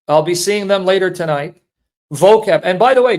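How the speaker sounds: normal talking voice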